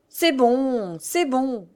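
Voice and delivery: high, drawn brush-off; impatient interruption. A narrator's reading. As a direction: cut him off with weary impatience. Mother cuts son off.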